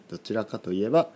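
normal talking voice

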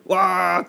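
short flustered "waah" shout; hammed-up startled yell; mock panicked yell. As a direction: let out a playful cry of alarm. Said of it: "わーってなるときですね".

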